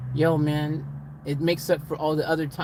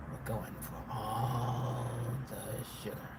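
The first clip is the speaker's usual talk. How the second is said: slow, soft, drawn-out murmur; hushed mock drama; 4 semitones lower, 11 dB quieter, slower than usual. Sugar makers promising all the sugar, hushed mock drama. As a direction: stretch it out low and hushed.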